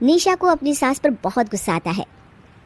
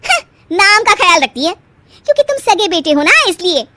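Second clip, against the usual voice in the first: louder, higher, tearful edge; a wife's louder, higher ultimatum to her husband; angry wife's ultimatum; 7 semitones higher, 5 dB louder than usual. Acted. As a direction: force him to choose.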